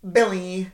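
"Billy" loud and high, every consonant fully sounded; over-clear consonants. Retold or imitated. Mimicking a girl whose mouth should be full, "Billy" over-enunciated. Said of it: "Full pronunciation of every consonant".

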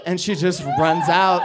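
ordinary talk

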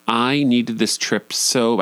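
ordinary talk, nothing marked

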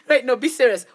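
ordinary talk, nothing marked

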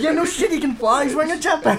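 high, loud, laughing comeback; mock exasperation. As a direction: play the exasperated moviegoer, hammed up. Plays exasperated neighbour in theater.

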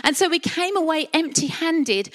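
usual speaking voice

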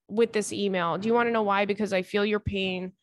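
ordinary talk

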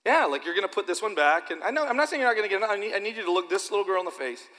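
usual speaking voice

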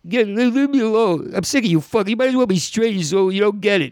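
high-pitched mock sobbing protest; fake cry. Hustler conning a client, fake crying. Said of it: "I would fake cry".

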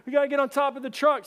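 high, loud acted panic; urgent yelling. Teen to friend as water pours in, urgent yell. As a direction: call out frantic orders. Urgent order in flooding truck.

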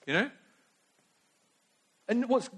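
ordinary delivery

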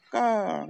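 high, falling crow caw; crow's call. Shown as a demonstration. Crows talking to each other, high calling caw. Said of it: "like a call and response".

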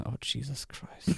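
slow, drawn-out sigh of disbelief; exasperated groan. Real, not acted. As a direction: groan it, worn out by the notes. Exasperated at another patch note.

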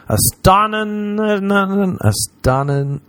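"astonin-, astonnin-" dragged out and broken off; tongue-tied stumble. Real, not acted. Podcaster failing to get out "astonin-, astonnin-", tongue-tied. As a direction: get stuck on "astonin-", stretching it slowly. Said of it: "then you try and say it".

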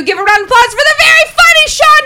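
stretched-out emcee shout; hype shout. Real, not acted. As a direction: pump up the crowd. Host introducing comic.

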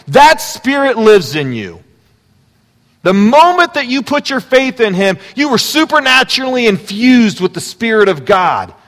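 loud, high preaching voice; full-force preaching. Acted. Pastor telling believers the Spirit lives in them, loud preaching. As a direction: drive it home to the room.

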